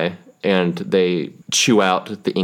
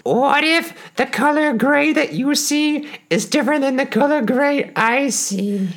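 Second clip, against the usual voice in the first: high, squeaky little dog voice; a tiny earnest puppy asking. Pretend. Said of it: "it raises its little paw and says".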